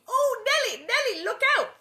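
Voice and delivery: high, loud warning scream. A narrator's reading. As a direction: shriek a false warning at her. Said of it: "and screamed".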